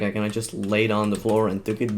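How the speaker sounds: ordinary delivery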